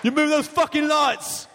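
put-on angry English accent, high and slow. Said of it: "Good English accent".

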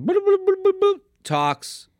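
high beeping phone tones, slow; high mock phone beeps; imitated phone beeps. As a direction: beep like a phone dialing. Phone dialing sounds imitated.

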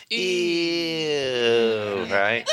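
put-on high, grossed-out kid's ew; mock childish disgust; kids going ew. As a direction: squeal it like a disgusted kid. Kids react to love talk.

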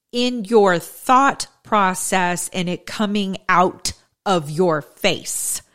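slow, measured, pausing between phrases; slow deliberate cadence. Shown as a demonstration. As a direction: drag each phrase out with pauses. Demonstrating deliberate halting cadence.

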